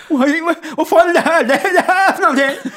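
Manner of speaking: high, loud tearful wail; near tears. Retold or imitated. Man panicking before his friend, hammed-up tearful garble. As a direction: blubber through a swollen tongue. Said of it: "har gråten i halsen".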